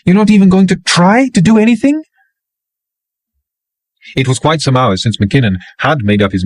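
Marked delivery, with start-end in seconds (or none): 0.0-2.0 high, louder disbelief; high-pitched voice, raised, pleading in disbelief; incredulous woman. A narrator's reading. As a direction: she demands he at least try. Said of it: "more than faintly incredulous".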